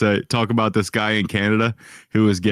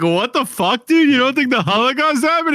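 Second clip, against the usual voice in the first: much higher, louder outraged disbelief; shocked guest; 13 semitones higher, 4 dB louder than usual. Retold or imitated. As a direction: squeal out the outrage. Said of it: "they'd be like".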